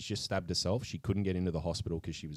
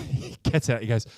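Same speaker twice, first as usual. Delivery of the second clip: ordinary delivery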